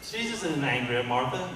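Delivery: normal talking voice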